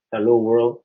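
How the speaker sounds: normal talking voice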